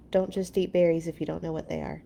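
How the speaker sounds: plain reading voice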